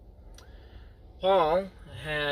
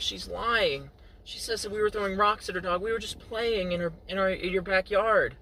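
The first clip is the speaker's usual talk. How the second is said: put-on pleading kid; 7 semitones higher than usual. Kid pleads innocence to friend's mom.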